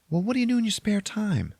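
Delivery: high-pitched, insistent questioning; exasperated schoolgirl. Acted. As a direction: press him in a high girl voice. Yearbook girl pressing him.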